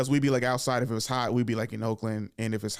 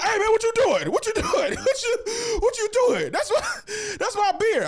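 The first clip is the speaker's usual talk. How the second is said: much higher, louder frantic protest; mock outraged uncle; 4 dB louder than usual. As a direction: panic like your beer's being poured out. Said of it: "He was like".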